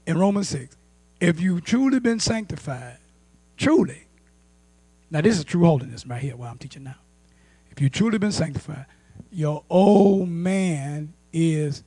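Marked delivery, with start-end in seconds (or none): none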